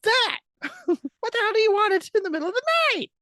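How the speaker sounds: high, fast mock tirade; angry mom impression. Retelling the mom yelling at the door, fast mock tirade. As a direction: snap it out like a woken mom. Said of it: "P J Mom opens the door".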